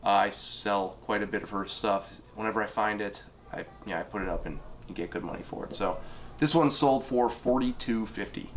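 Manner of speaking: ordinary talk, nothing marked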